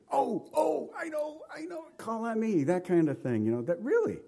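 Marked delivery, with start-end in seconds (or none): 0.1-2.6 high, excited schoolkid bidding for the teacher; much higher, keen child voice; eager second grader. Retold or imitated. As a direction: squeal like a child waving a hand in class. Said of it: "like that second grader".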